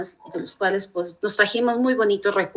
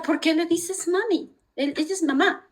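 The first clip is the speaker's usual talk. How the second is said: pregunta aguda y lenta del yerno; yerno extranjero imitado; 9 semitones higher than usual. Retold or imitated. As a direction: imita al yerno curioso, más agudo. (yerno preguntando por qué le dice mami, agudo)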